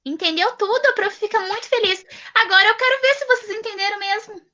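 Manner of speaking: high, bright praise; delighted teacher. Acted. Teacher delighted that a pupil understood, excited praise. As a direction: sound thrilled for the child.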